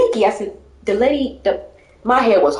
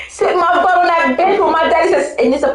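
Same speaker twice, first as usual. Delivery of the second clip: laughing through the words, higher; 6 semitones higher than usual. Real, not acted. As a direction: giggle it out while picturing the bench. Dreaming of ice cream on bench.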